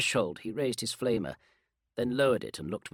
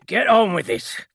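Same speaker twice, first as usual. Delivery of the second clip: grim order, higher and louder; a hint of a grim sergeant insisting; 9 semitones higher, 11 dB louder than usual. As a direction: clamp down with grim authority. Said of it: "said Zalsk grimly".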